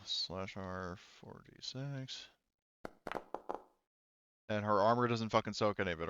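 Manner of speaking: ordinary talk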